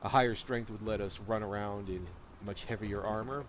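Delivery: ordinary delivery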